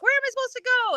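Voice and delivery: high-pitched excited asking; bewildered question. Retold or imitated. Runner having to ask the way, high bewildered call. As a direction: ask it high, needing directions. Retold asking for directions mid-race.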